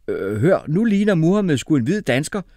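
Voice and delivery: hesitant start, then high loud call; shouting. A narrator's reading. Umfufu joking to classmates about Mohammed, shouting. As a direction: call out the joke, pitched high. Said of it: "råbte Umfufu".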